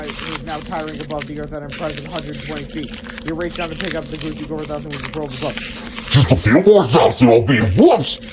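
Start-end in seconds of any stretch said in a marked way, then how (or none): 6.1-8.3 loud, slow cry breaking into whoops; startled cry. A narrator's reading. Giant losing grip on the shrink ray, crying out. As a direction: let the line collapse into a loud whoops. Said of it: "cried Slayer".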